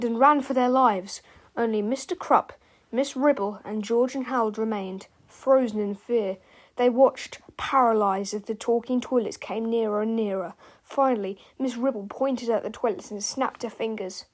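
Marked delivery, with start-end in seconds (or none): none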